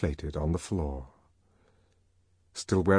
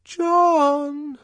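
high coaxing call through a door; high coaxing call, small and ingratiating; 5 dB louder than usual. Acted. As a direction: wheedle him from the bathroom. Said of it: "a small, ingratiating voice".

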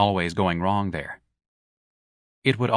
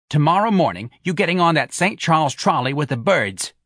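higher, louder incredulous mother; Mrs Reilly's voice; 7 semitones higher, 4 dB louder than usual. Acted. Mother disbelieves her son about the sheet, higher and mocking. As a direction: scoff at his lame excuse.

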